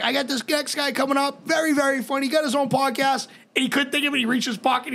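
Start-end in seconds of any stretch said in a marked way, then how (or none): 0.0-3.3 lightly voiced host hyping the next comic, a bit higher; a hint of an emcee announcing; emcee intro voice. Jerry introducing the next comic on stage, light emcee voice. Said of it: "he gets up there and he's like".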